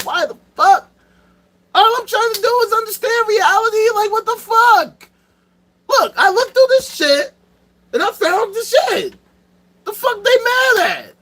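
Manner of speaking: high-pitched mock anguish, near tears and shouting; exasperated Galileo. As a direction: play a fed-up scientist crying out at the world. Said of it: "'cause he's like".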